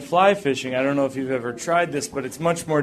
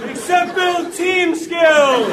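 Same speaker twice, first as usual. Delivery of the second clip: frustrated yell; slower than usual. Pretend. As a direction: yell at a useless parachute. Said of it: "I'm like".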